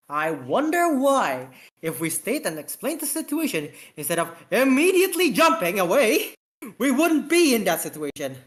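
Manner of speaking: sarcastic scolding. Acted.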